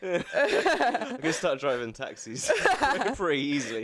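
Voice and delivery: high, loud laughter through the words; slow, helplessly laughed-out words; laughing. Real, not acted. Joking to partner about driving taxis, laughing hard. Laughing at own joke in banter.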